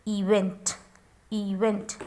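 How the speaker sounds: teacher modelling the long E in event. Shown as a demonstration. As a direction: say event as ee-vent. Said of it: "pronouncing this E as a long E".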